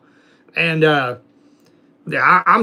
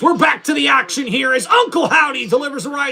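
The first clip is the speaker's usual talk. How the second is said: higher, excited wrestling commentator call; play-by-play commentary; 6 semitones higher than usual. Acted. Streamer calling the wrestling game for chat, excited play-by-play. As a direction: call the match like a TV announcer.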